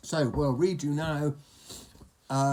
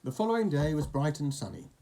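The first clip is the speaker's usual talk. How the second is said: usual speaking voice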